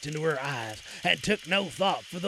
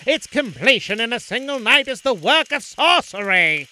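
oily scheming vizier; 4 semitones higher, 7 dB louder than usual. A narrator's reading. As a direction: speak gravely with hidden malice. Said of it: "replied the Vizier gravely".

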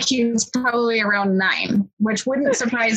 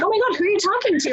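high, fast, alarmed outburst; startled mom; 11 semitones higher, much faster than usual. Retold or imitated. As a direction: blurt it out like a startled mother. Mom walks in on son's chat.

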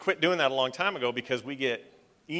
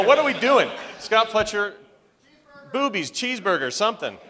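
hyped; 10 semitones higher, 8 dB louder than usual. Acted. Mock catchphrases, hyped.

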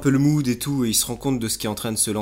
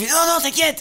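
very high, light cartoon voice; squeaky mock reassurance. The pigeon tells Flantier not to worry, very high and squeaky. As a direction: soothe like a silly cartoon bird.

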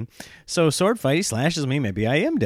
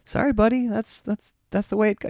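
put-on higher, sing-song fibbing to a kid; fake cheerful parent; 9 semitones higher than usual. Dad fibbing to his son about the toaster. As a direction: sweet-talk the lie. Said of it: "And you're like".

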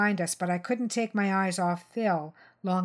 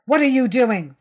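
loud, raised-pitch challenge; shouting; 5 semitones higher, 10 dB louder than usual. A narrator's reading. Phil challenging the mother as she passes, shouting. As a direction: shout it out as a sharp demand. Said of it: "Phil shouted".

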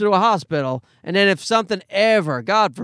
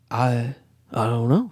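dazed zombie; 7 semitones lower than usual. Retold or imitated. Wife to the nurse, a low dazed zombie mumble. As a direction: sound like a half-awake zombie. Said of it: "We were both zombies".

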